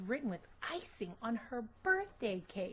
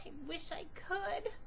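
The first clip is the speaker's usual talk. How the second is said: higher, tearful wish; miserable; 8 semitones higher than usual. Acted. Girl wishing she could change her name, tearful. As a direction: let it catch in the throat. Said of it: "thought Chrysanthemum miserably".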